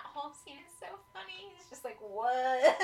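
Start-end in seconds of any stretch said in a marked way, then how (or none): 2.0-2.8 loud, high stunned imitation; exaggerated, drawn-in-by-surprise reaction; mock astonishment. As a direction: play a character floored by what he sees.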